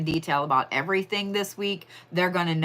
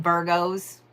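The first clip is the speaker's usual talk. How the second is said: usual speaking voice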